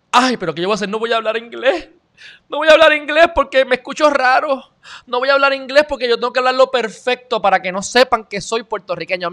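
high whiny mock of a self-conscious Puerto Rican; mocking insecure speaker. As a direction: whine like someone ashamed of their accent.

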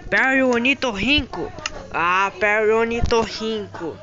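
loud sung-out hero announcement; calling out. Acted. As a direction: belt out his name. Announcing Perry's arrival.

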